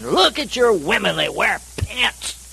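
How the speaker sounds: high-pitched mock preacher rant; scolding preacher. Street preacher berates Baptists about their women, mock scolding.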